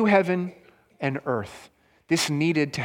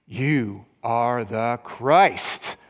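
low, slow, reverent declaration; solemn confession; 7 semitones lower, much slower than usual. Retold or imitated. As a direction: lower and slower, as Peter proclaiming. Peter confesses Jesus as Messiah.

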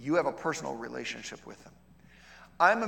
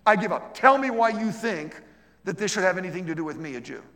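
giving in; 4 semitones higher, 9 dB louder than usual. Retold or imitated. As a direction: throw up your hands and give in out loud. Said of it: "And I said".

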